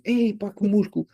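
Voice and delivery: lightly hammed-up pleasant surprise; mock surprise. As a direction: play suddenly noticing the result. Acts surprise at new muscle.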